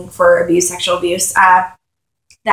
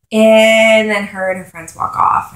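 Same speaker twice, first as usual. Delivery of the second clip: usual speaking voice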